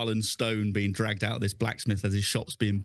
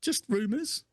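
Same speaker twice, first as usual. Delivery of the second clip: much higher, stammering and squeaky; nervous pleading; 10 semitones higher than usual. Acted. Dangled carpenter pleads with his captor, squeaky and nervous. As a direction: squeak out your excuses while dangling.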